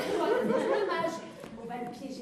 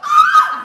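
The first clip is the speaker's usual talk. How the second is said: shrill scream; 23 dB louder than usual. Acted. Cri de panique.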